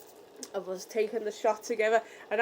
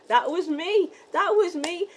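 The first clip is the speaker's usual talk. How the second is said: excited; 6 semitones higher, 4 dB louder than usual. Retold or imitated. Proud confirmation.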